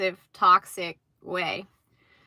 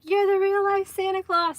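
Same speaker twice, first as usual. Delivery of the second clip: much higher, starstruck gush; retold gushing; 11 semitones higher than usual. Thanking a man who looked just like Santa, high gushing.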